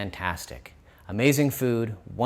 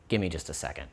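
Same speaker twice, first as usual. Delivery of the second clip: usual speaking voice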